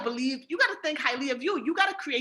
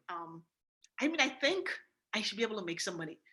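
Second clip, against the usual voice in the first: much softer and lower, hesitant and mumbling; unsure and timid; 6 semitones lower, 10 dB quieter than usual. Shown as a demonstration. Pitching a prospect, timid and unsure. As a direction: fumble it like you don't believe yourself. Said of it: "You can't say to someone".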